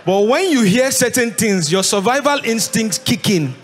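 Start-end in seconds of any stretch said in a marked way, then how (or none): none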